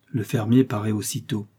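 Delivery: usual speaking voice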